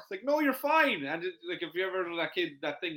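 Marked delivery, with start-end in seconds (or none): 0.2-1.0 much higher soothing no; calm a child who has just fallen; a bright, comforting you're fine; mock reassuring parent.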